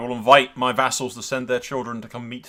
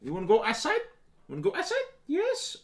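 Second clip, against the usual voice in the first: much higher, sing-song puppy talk; 3 dB quieter than usual. Real, not acted. Owner asking the puppy if she wants out, high baby talk. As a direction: coo at the dog.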